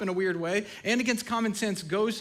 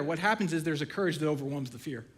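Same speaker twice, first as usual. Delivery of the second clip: usual speaking voice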